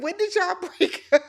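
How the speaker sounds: high-pitched question broken by laughter; laughing disbelief. Retold or imitated. Recapper questioning a supposed breakup, laughing in disbelief.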